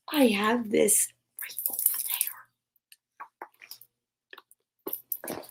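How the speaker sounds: unvoiced whispered aside; hushed aside to the listener. Real, not acted. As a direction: whisper it off to the side. Aside while reading.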